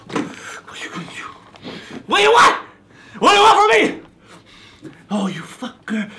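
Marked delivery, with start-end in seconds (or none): 2.1-4.0 high, loud exasperated yell; frustrated shout. Acted. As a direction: scream it at the pumpkin. Raging at pumpkin.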